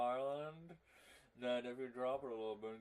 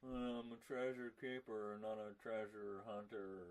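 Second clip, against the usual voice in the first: Nicolas Cage impression; 4 semitones lower, 5 dB quieter than usual. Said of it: "Nicolas Cage impression".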